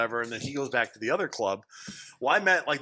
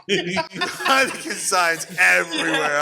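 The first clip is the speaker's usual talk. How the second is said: slow words broken by laughter; laughing; 4 semitones higher, 6 dB louder, much slower than usual. Real, not acted. Telling friends about the Heineken signs in Amsterdam, laughing. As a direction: laugh through it, drawing it out. Laughs at his own joke.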